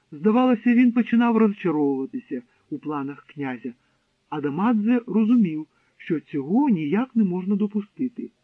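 plain reading voice

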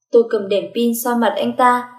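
ordinary delivery

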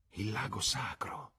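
slow reverent whisper; hushed exclamation. Acted. Lucy names the pond to Cecil in an awed whisper. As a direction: breathe the name like a sacred secret. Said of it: "Lucy esclamò".

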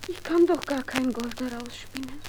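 normal talking voice

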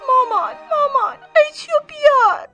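high, loud shout breaking into tears; crying shout. A narrator's reading. Girl screams to her mother for scissors, crying. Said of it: "ملوری فریاد زد".

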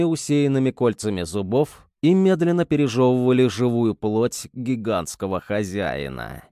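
ordinary delivery

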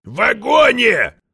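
high, drawn-out shout; roared correction. A narrator's reading. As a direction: bellow it slowly into a hearing aid. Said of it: "рявкнул ему прямо в слуховой аппарат". (рявкнуть во весь голос)

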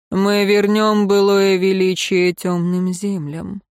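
plain reading voice

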